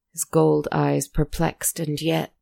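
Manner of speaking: ordinary delivery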